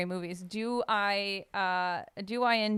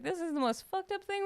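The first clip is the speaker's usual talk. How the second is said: high, stunned mock gasp of a line; acting out the horror of the film; shocked disbelief; 9 semitones higher, 3 dB quieter than usual. Friends staring at each other during Midsommar, high stunned disbelief. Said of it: "looking at each other like".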